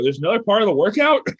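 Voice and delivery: stunned that the workout isn't over; incredulous question. Retold or imitated. Retold shock at more workout.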